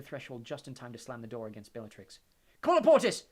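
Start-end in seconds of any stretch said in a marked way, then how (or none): 2.6-3.2 loud, high spell shout. A narrator's reading. Harry shouts a locking spell at the door. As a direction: fire the spell out. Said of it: "shouted Harry".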